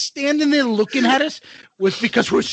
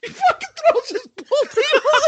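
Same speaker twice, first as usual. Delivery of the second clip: ordinary talk, nothing marked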